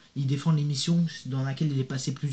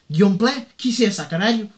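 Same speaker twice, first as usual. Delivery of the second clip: put-on mother's Portuguese accent, louder; 4 semitones higher, 6 dB louder than usual. Son mimicking his Portuguese mother's accent. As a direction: imitate his Portuguese mom asking who that is. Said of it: "elle va le faire avec l'accent portugais".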